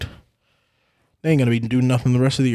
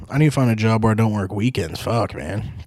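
ordinary delivery

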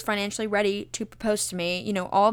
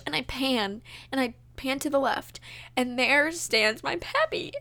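ordinary talk

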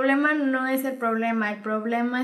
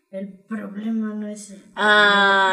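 put-on slow, louder drunk voice; 3 semitones lower, 6 dB louder, slower than usual. Shown as a demonstration. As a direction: drag it out like you're drunk. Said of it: "Intenta escucharlo como en un tono borracho".

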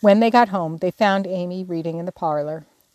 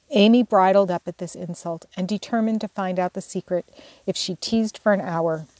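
usual speaking voice

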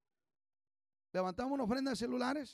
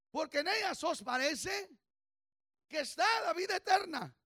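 louder, higher preaching; fervent preaching; 4 semitones higher, 4 dB louder than usual. Acted. Pastor challenging the church about scripture, fervent. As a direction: press the congregation hard.

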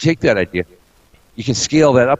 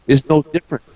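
ordinary talk, nothing marked

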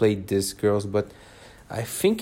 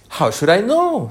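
bold, higher and louder retort; surprised at own courage; 9 semitones higher, 5 dB louder than usual. A narrator's reading. Alice boldly answers the Queen, surprised at herself. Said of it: "surprised at her own courage".

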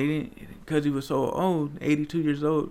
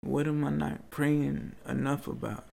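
ordinary talk, nothing marked

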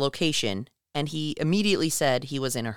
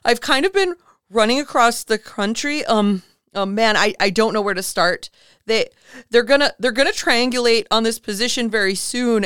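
higher and louder, a hint of tearful panic; panicked caller; 6 semitones higher, 6 dB louder than usual. Podcaster retelling the Area 51 caller, higher and near tears. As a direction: voice the desperate caller, voice near breaking. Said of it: "then he starts sobbing".

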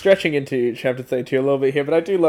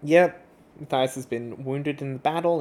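normal talking voice

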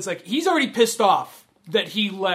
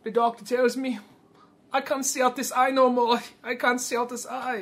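higher, plaintive put-on voice; mock sad; 5 semitones higher than usual. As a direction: imitate a wounded man feeling sorry for himself. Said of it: "he's all sad".

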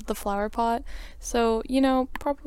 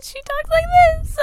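put-on much higher squeaky girl voice; high Sophie voice; 7 dB louder than usual. Shown as a demonstration. Player tries out Sophie's voice, much higher and squeaky. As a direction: pitch it way up like a little girl. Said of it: "I should try to do a voice".

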